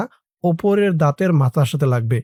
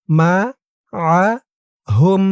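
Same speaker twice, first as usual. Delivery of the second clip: মাআহুম broken slowly into মা, আ, হুম with pauses; syllable by syllable; 2 semitones higher, much slower than usual. Shown as a demonstration. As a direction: spell out মাআহুম one separate syllable at a time. Teacher splits word into syllables.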